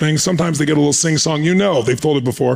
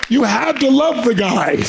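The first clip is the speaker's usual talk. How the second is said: high, slow, emphatic retold admiration; imitated Gorbachev; 8 semitones higher, slower than usual. Leader recalling his rival's fresh start, imitated admiration. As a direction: draw it out high, as an imitated tribute. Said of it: "And he said".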